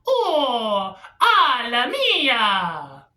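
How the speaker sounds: high, drawn-out Mario parody cry; hammed-up Mario wail, stretched long.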